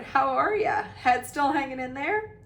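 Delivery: high, loud, hearty ribbing; boisterous hail across the crowd; roared greeting. A narrator's reading. Headless ghost teasing the host, roaring. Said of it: "he roared".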